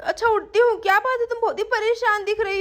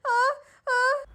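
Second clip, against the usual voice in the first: pain cries; 3 semitones higher than usual. Acted. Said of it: "बहुत ही दर्द हो रहा".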